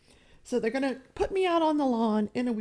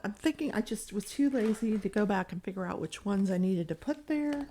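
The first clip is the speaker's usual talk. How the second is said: ordinary talk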